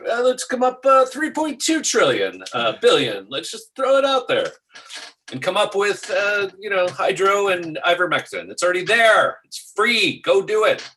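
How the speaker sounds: high-pitched mock of careless spenders; mocking exasperation. Co-host mocking billions spent on pills, high-pitched and incredulous.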